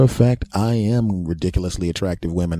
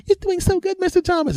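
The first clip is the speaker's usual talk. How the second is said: very high, sweet encouragement; cheering women. Retold or imitated. Women encouraging Mister Thomas on the treadmill, very high and sweet. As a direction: coo encouragement at the runner.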